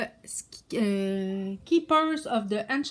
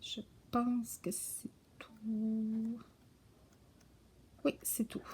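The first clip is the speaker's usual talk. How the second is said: soft whispered wrap-up; 9 dB quieter, much slower than usual. Real, not acted. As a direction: whisper it, trailing off. Quiet closing aside. (chuchoté doucement)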